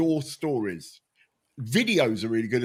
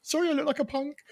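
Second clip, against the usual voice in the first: much higher, apologetic squeak; nervous high voice. Retold or imitated. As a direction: grovel in a high frightened voice. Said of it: "why my voice gone this high".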